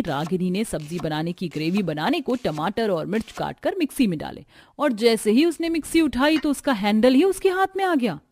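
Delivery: ordinary delivery, nothing marked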